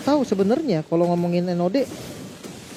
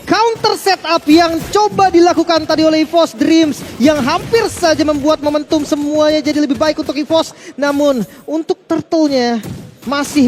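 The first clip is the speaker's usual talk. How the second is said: excited caster; 7 semitones higher, 4 dB louder than usual. Acted. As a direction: build the hype over the fight. Caster hyped during a fight.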